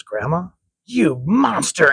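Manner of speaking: acted outrage, slow, rising high and loud on the insult; angry accusation. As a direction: stretch it out like an appalled comic-book grandmother. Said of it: "Her face turns angry".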